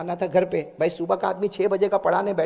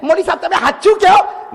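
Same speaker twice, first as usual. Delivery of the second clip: joyful question; 11 semitones higher, 9 dB louder than usual. Retold or imitated. Delighted man asks joyfully.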